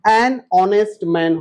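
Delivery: An honest man spoken slowly, an linked onto honest; slow model. Shown as a demonstration. Teacher modelling An honest man slowly for the class. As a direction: spell it out slowly, an then onest. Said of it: "तो यहाँ क्या हो जाएगा?".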